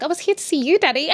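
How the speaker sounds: put-on high, girlish greeting; mock sweet daughter. Sarah greets her father at his office, mock sweet. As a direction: imitate an eager daddy's girl.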